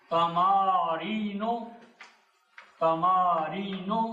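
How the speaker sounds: "तमारीनो" slow, ending on an open vowel; no tanween. Shown as a demonstration. Teacher modelling for students, "तमारीनो" slowly without tanween. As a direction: draw out "तमारीनो" with no final n. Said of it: "इस पे तनवीन नहीं पढ़ी जाएगी".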